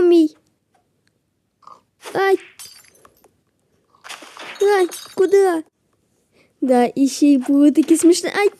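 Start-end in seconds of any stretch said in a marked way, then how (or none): none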